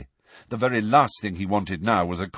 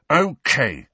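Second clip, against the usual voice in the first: loud, curt, hostile okay; aggressively; 7 semitones higher, 5 dB louder than usual. A narrator's reading. Harry snapping at Hermione, loud and curt. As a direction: bite it off at her. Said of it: "Harry said aggressively to Hermione".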